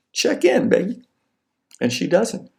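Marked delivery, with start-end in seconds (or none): 0.1-1.0 very high, needy sweetheart voice; insistent texter. Acted. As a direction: push the pitch way up, pleading. Acted follow-up text to girlfriend.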